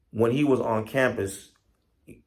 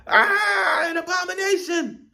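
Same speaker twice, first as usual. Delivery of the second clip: terrified scream; 5 dB louder than usual. Acted. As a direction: scream it in alarm as the fleeing rat. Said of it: "runs out into the forest floor and screams".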